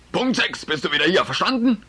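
lauter, hoher Befehl des Vaters; brüll die Zeit als Befehl hinterher. Acted. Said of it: "Das war laut genug". (Vater ruft dem Sohn laut die Uhrzeit nach)

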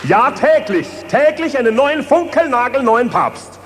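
very high, loud gleeful shout; ecstatic shout. Acted. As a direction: shriek it out in delight. Ecstatic demand for new pope.

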